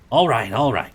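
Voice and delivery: loud, irritated all right, all right; a loud impatient snap; snapping. Acted. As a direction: bark it out, fed up. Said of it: "Crowley snapped".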